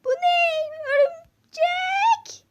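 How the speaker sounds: very high, loud, drawn-out scream; scared shriek. Acted. Customer spotting a spider in the shop, long terrified shriek. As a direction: shriek the word slowly in horror.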